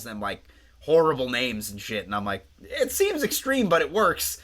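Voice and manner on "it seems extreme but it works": mock reaction. Host voicing her own reaction to the doctor, squeaky high. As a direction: squeak it out in exaggerated approval.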